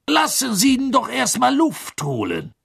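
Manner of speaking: high-pitched hearty official; Ludo Bagman. A narrator's reading. Relieved Bagman defends Harry.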